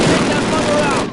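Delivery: high, loud furious command; shouted order. Acted. Captain ordering Martin to destroy the tank, loud shout. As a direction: bark it at him over the battle.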